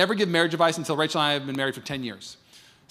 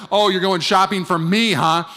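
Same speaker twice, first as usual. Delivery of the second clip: sarcastic; 4 semitones higher, 8 dB louder, slower than usual. Retold or imitated. Sarcastic retort.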